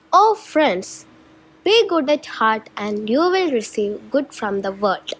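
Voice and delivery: plain reading voice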